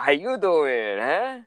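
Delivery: put-on drawn-out Italian-accent greeting, rising at the end; mock Italian voice. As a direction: put on a playful Italian accent, lifting the final word. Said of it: "In the Italian voice".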